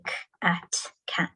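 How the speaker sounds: "k", "at", then blended "cat", fading to a whisper; sounding out. Shown as a demonstration. Teacher showing how children sound out words. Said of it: "needing to say".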